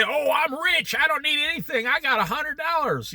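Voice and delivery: put-on high, smug bratty kid voice; snobby little brat. Spoiled child bragging about birthday cash, high and smug. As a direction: play the spoiled ten-year-old bragging. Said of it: "turn into a snobby little brat".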